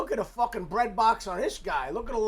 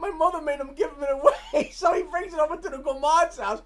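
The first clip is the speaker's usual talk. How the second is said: laughing; 6 semitones higher, 3 dB louder than usual. Real, not acted. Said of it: "laughing while retelling scene".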